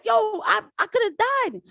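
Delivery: high-pitched mock protest; retold protest. Woman saying she nearly died, retold protest. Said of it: "she's like".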